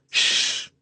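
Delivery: sharp voiceless shush; harsh hiss. Acted. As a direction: hush him with a harsh hiss. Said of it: "The harsh, sibilant hiss".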